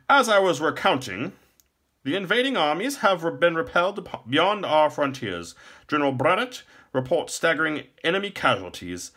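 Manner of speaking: high, loud courtly report; courtly minstrel chancellor, declaiming. A narrator's reading. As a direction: declaim war news to the king.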